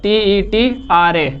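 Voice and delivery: very slow, letter by letter; slow spelling. Shown as a demonstration. Teacher spelling tetra for students to remember, slow and deliberate. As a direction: spell "T E T R A" one letter at a time. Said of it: "याद रखिएगा".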